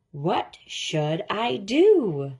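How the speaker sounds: plain reading voice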